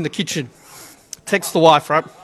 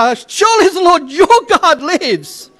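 "your" rising, higher and sceptical; disillusioned widow, edged "your"; bitter doubt; 6 semitones higher than usual. Shown as a demonstration. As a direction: say it as someone who no longer believes. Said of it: "seen too much sickness and death".

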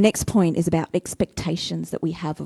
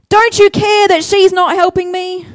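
higher, louder indignant outburst; higher, louder exasperated sister; barging-in complaint; 10 semitones higher, 8 dB louder than usual. Acted. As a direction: burst in and accuse. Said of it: "she comes barging in going".

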